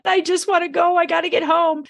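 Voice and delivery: you just want to go home, sigh it out; exasperated inner voice. Retold or imitated. Exasperated thought, car pulls up.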